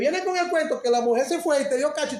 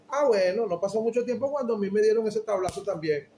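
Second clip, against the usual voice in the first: ordinary talk, nothing marked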